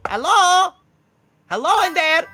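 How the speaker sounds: high, loud bird voice; kookaburra messenger. Acted. Messenger knocking at Pickle Juice's door, high kookaburra call. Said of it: "a blue kookaburra".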